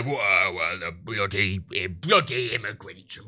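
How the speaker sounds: low, slow grumbling bloke saying bloody immigrants; a lightly voiced sarcastic bigot grumble; bigot impression. Mocking a bigot for stream viewers, low sarcastic grumble. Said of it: "you do the, "Whoa," voice".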